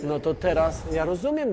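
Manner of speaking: usual speaking voice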